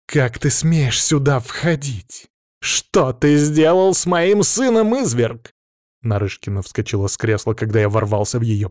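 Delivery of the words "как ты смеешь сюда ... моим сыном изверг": high, slow, indignant cry; loud, high voice of an indignant tsaritsa; outraged mother. A narrator's reading. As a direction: stretch each word in rising outrage. Outraged mother confronts the intruder.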